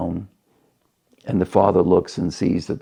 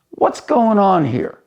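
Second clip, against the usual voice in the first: high-pitched indignant demand; acted protest from a fed-up son; exasperated outburst; 12 semitones higher, 3 dB louder than usual. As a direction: burst in, pitch leaping up in disbelief. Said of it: "can't take it anymore".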